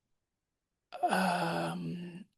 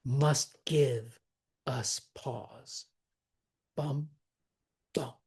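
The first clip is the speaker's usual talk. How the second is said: "Must give us pause", then two counted beats; decent pause; slower than usual. Shown as a demonstration. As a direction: hold the pause after "pause", marking it bum, bum. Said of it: "take a decent pause".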